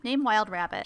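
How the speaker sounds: ordinary talk, nothing marked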